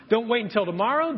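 usual speaking voice

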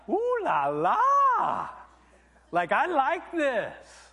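high, loud, drawn-out ooh la la; leering delight. Retold or imitated. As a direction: ogle her out loud, hammed up. David ogling bathing woman.

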